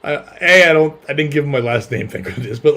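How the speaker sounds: ordinary delivery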